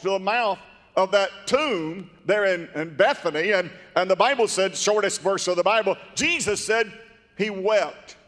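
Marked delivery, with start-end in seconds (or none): none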